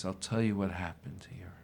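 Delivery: unvoiced, hushed reply; whisper. Master whispering the answer to his student. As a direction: breathe it like a secret.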